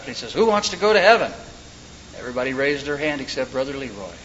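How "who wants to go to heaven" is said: mock preacher rallying the congregation, higher pitch; fired-up preacher. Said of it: "getting the congregation all fired up".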